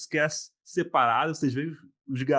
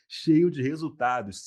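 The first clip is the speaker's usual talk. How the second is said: normal talking voice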